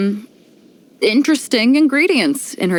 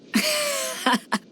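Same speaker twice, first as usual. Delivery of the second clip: a bright, high burst of laughter trailing off; hearty laugh; 7 dB quieter than usual. Acted. Captain laughing at her own remark, hearty laugh. Beidou chuckles between lines.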